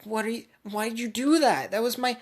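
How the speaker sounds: outraged high voice, pushed up and loud; triggered outburst. Acted. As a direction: shriek at him for breaking her best blade. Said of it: "triggered by this".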